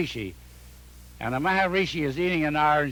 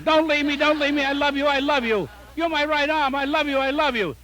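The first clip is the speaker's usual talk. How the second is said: desperate plea, hammed up; pleading husband; 8 semitones higher than usual. Beethoven begging his wife to stay, high desperate pleading.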